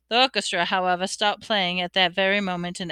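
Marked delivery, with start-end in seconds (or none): none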